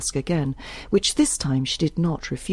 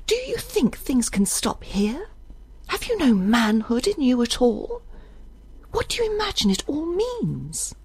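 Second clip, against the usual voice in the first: very high-pitched, reproachful woman's voice; Isbel, challenging; 9 semitones higher than usual. A narrator's reading. Isbel faces Judge over his manhood, challenging. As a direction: confront him in a high, pressing voice.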